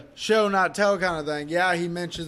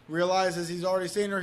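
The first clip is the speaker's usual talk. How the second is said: normal talking voice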